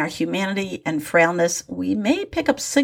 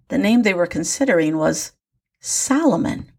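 ordinary delivery, nothing marked

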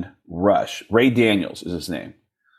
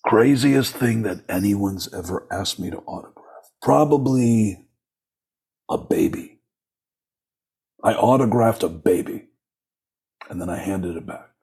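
[name]. Retold or imitated. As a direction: imitate a self-important rock star, measured and unhurried. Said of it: "And Gene goes".

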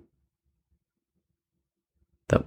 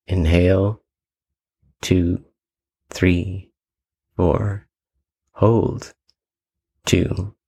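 slower breath count, longer gaps between numbers; much slower than usual. Shown as a demonstration. Guide showing listeners a slower square breath, slow spaced counting. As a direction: count out each breath slowly, pausing after every word. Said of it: "to do it slower".

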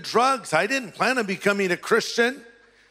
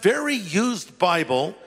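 normal talking voice

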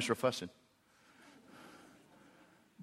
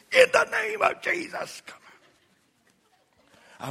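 mock faith healer; 6 dB louder than usual. Father mocking a healer over his daughters, loud and high. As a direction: ham up a laying-on-of-hands healer. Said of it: "I've never laid hands on my girls".

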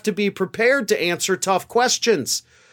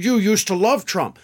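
lightly voiced indignant accusation; a hint of a huffy lawyer; mock indignant. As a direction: toss it off like a huffy spokesperson. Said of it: "flying off the handle".

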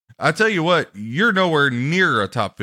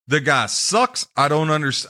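ordinary delivery

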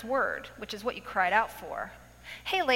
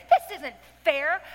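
mock protest; 4 semitones higher, 6 dB louder, slower than usual. Retelling her protest at the book.

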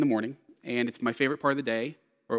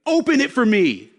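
put-on high, loud toddler order; bossy little girl; 9 semitones higher, 8 dB louder than usual. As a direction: demand it like a spoiled four-year-old. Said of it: "she goes".

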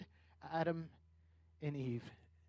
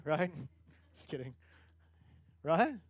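ordinary delivery

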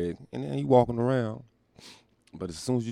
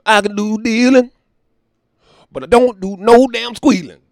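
[name] impression; 13 semitones higher, 13 dB louder than usual. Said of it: "to quote the great philosopher [name]".

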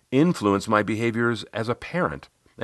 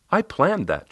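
higher voice of a quipping client; client's quick comeback, pitched up; Riley; 8 semitones higher, slower than usual. Retold or imitated. Client joking back to her coach, higher voice. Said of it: "she said".

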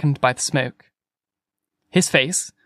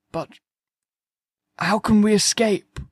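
higher-pitched despairing question; distraught; 7 semitones higher than usual. A narrator's reading. Curate suddenly asks about escape, distraught. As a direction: blurt it as a frightened curate. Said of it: "he asked suddenly".

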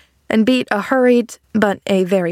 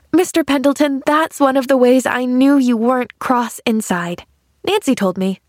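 put-on higher child's voice, happily confiding; admiring little girl Pollyanna; 6 semitones higher than usual. Girl telling man his secret, happily admiring.

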